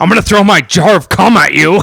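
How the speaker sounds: high mock threat; hammed-up outraged buddy voice; mock angry friend. Co-host joins in as the mad friend, mock angry.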